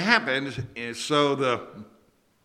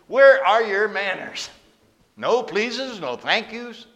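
higher and louder, scolding; mock scolding; 8 semitones higher, 9 dB louder, slower than usual. Samaritan woman scolding the rude stranger, hammed-up reproach. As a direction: tell him off like a stern matron. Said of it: "she would have definitely scolded me".

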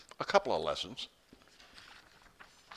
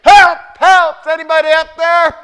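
desperate high yell for help; crying out for help; 17 dB louder than usual. Acted. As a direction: scream up the cliff for help. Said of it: "he starts crying out".

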